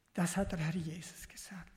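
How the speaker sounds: whispered solemn affirmation. Real, not acted. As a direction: let it land in a hushed breath.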